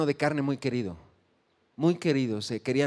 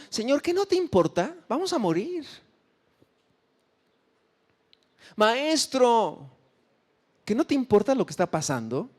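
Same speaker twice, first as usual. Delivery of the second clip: desperate plea; 7 semitones higher than usual. Acted. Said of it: "Ese fue el reclamo".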